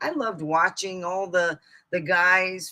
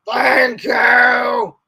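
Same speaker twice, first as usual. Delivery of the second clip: retold yell; 5 semitones higher, 8 dB louder than usual. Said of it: "my sibling yelled at".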